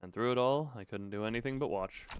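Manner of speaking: low, measured story reading; subdued narration. Acted. Clock-headed manager thinking over the gas, subdued narration. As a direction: read it as a resigned inner monologue. Reads the narrator's inner monologue.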